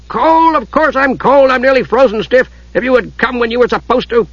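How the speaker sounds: high, worked-up dwarf outburst; indignant dwarf. A narrator's reading. Freezing dwarf rebukes the late Kender, indignant. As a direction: burst out in cranky indignation. Dwarf explodes at innocent question.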